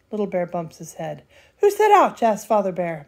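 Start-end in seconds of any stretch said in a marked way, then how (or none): 1.6-2.2 high loud who; surprised call. Acted. Father calls out loudly.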